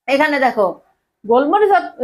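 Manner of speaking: usual speaking voice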